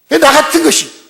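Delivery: high, loud mock-panicked objection; cowed protest. Timid Gideon refusing the angel's call, cowed protest. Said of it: "쫄아가지고 뭐라 합니까".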